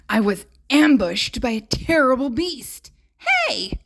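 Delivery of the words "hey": a sudden, very high yelp of hey; startled hey. Acted. Beast ambushed by the girl, startled yelp. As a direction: squeak it out in shock.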